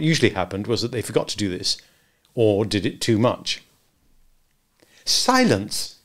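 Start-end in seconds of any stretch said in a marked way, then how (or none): 5.1-6.0 cried out. A narrator's reading. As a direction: snap them into silence. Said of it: "cried Wendy".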